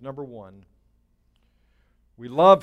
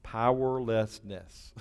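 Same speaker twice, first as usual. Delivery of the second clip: drawn out slowly and quieter, every syllable spelled; slow, softer dictation; 4 semitones lower, 8 dB quieter, slower than usual. Shown as a demonstration. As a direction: Powerlessness repeated slowly and softly, letting it sink in. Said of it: "Write that down".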